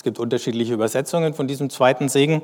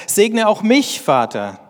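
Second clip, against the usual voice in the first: high, louder pleading; Esau's anguished plea, much higher; bitter cry; 7 semitones higher, 3 dB louder, slower than usual. Acted. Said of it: "schrie er heftig auf, aufs Äußerste verbittert".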